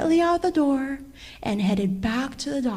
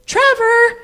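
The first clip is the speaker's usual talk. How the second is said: much higher, loud yell; shouting; 12 semitones higher, 9 dB louder, slower than usual. A narrator's reading. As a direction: call out across the water.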